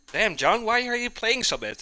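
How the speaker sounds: high-pitched mock questioning; mock complaining teammate. Acts out teammate questioning John.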